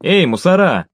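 restrained narrated shout; calling out. A narrator's reading. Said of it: "из склада закричали".

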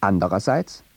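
ordinary delivery

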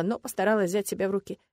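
ordinary delivery, nothing marked

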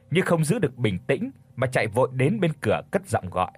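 ordinary delivery, nothing marked

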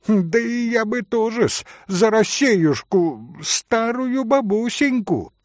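tittering. Acted. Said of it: "подхихикнул Максимов".

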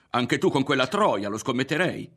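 high, loud furious scolding; angry accusation. A narrator's reading. Mother scolding her son over Lidia, angry accusation. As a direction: lay into him, pitched high and forceful.

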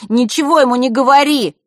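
loud, high yell from the floor; shouted warning. A narrator's reading. Брат с пола выкрикивает предупреждение сестре. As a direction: cry out a warning. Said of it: "выкрикнул Эрик".

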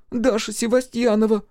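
put-on a scared village girl answering; timid high young girl; frightened girl. A narrator's reading. Frightened girl voiced by narrator.

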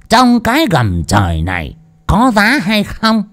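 put-on high, slurred, bragging drunk; drunk freeloader. A narrator's reading. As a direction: brag like a tipsy know-it-all. Drunk lecturing the crowd.

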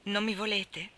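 ordinary delivery, nothing marked

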